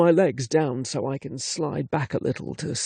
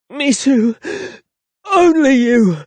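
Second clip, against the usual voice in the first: loud, high, breathless declaration; impassioned; 8 semitones higher, 7 dB louder than usual. Acted. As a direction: cry it out with passion. Impassioned reply mid-sex.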